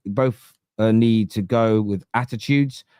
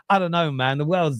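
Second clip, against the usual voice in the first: normal talking voice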